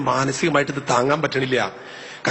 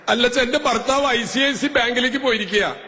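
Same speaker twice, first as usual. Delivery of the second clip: voiced woman caller; 11 semitones higher than usual.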